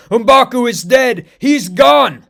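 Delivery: high, loud, slow anguished shout; frantic shout. Acted. Okoye shouting to the king that M'Baku is dead. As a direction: shout the terrible news. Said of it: "Okoye screams as she yells".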